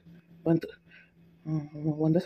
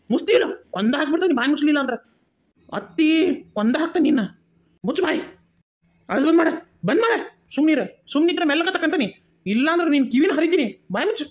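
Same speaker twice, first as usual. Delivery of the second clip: high, loud, fast threatening yell; furious shout; 9 semitones higher, 4 dB louder than usual. Acted. Threatening the crying child to stop wailing, furious shout.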